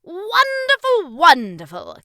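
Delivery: booming praise, starting very high then dropping; enthused. A narrator's reading. King applauds boy's progress, hugely enthused. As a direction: gush loudly, stretching the first word. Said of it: "King Shumiwami enthused".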